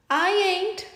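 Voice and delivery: snickering. Acted. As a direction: sneer a cheeky no. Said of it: "snickers Mademoiselle Possum".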